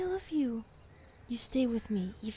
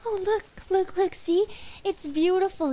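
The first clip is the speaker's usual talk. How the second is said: excited; 7 semitones higher, 6 dB louder than usual. Acted. Shows lover the clearing.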